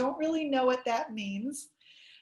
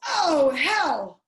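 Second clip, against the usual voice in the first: loud, drawn-out exasperated outburst; stressed yell; 2 semitones higher, 8 dB louder than usual. Acted. Daddy driving when somebody cuts in front, stressed yell. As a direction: yell it like a startled driver. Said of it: "and daddy yells".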